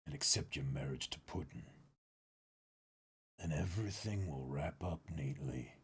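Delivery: low, soft threat with a whispered start; quiet menacing king. Acted. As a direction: lean in and warn him softly. Voicing Judge threatening Sanji.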